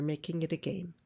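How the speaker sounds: usual speaking voice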